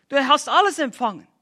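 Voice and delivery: ordinary talk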